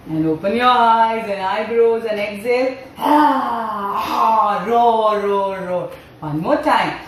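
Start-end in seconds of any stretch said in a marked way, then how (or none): 4.7-5.9 lion-pose roar. Shown as a demonstration. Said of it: "take our tongue out and do roar".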